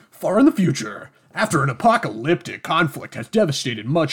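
loud hyped announcer voice; monster truck announcer. Acted. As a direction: boom it like a truck rally ad. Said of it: "monster truck announcer".

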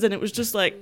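normal talking voice

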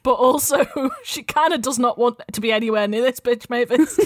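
usual speaking voice

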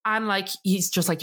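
ordinary delivery

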